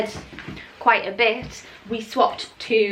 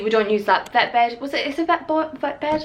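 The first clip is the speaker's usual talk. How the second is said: usual speaking voice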